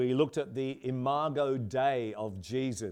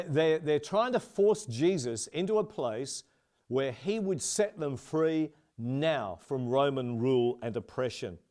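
usual speaking voice

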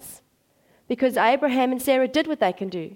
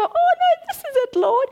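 very high, loud mock excitement; comically frantic enthusiasm; overexcited; 5 dB louder than usual. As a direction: squeal it out as a hammed-up discovery. Acts people stuck on stuff.

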